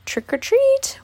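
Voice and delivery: usual speaking voice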